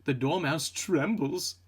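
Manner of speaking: ordinary talk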